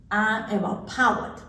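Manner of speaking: slow "poet" said as PAH-wut; mispronounced parrot. A narrator's reading. Parrot trying to say parrot, saying "poet" as PAH-wut. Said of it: "which she couldn't say parrot".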